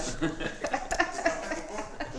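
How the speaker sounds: laugh. Real, not acted. Laugh at the father.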